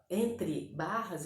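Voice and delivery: normal talking voice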